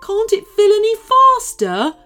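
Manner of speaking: high, loud anxious creature; worried snake. Acted. Worried snake urging Liam, high anxious voice. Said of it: "asked the worried-looking snake".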